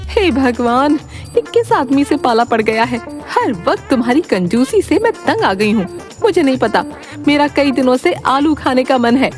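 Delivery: high-pitched, exasperated nagging; fed-up wife. Acted. Wife threatening no dinner over his miserliness, fed up.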